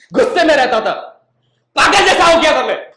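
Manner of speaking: loud, high, worked-up retelling of rage; angry outburst. Retold or imitated. Jilted man telling how crazed he became, angry shouting. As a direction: burst out as if losing your mind. Acts out his past rage.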